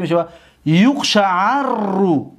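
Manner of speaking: یقشعررو slowly with the long rr; dictating یقشعررو slowly; slow drilled form. Shown as a demonstration. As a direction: drill یقشعررو at half pace.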